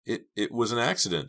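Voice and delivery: nervous, halting insistence; stammering. A narrator's reading. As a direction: trip over the first word. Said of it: "Dina stammered".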